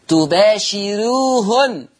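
تباشروهن very slowly, the ر thin; light r, slow. Shown as a demonstration. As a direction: drag out تباشروهن, keep the ر light. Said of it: "قرأ ورش بترقيق الراء". (ترقيق الراء في تباشروهن ببطء)

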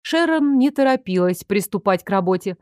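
usual speaking voice

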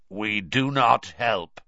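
slow, harsh croaking merman; harsh croaky voice. Acted. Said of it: "he said in a harsh, croaky voice".

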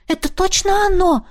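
ordinary delivery, nothing marked

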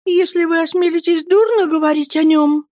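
a timid widow bristling; indignant young mother. Acted. (высоким голосом, с обидой)

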